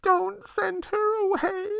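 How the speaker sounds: put-on high, pleading sob of a frightened girl; sobbing. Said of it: "she sobbed".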